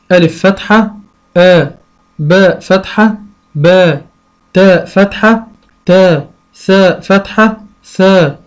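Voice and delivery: slow, spelled out letter by letter; name each letter, then its sound, slowly; patient teacher drilling a child; slow teaching recitation. Shown as a demonstration. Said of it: "فأنا أعلمه إزاي".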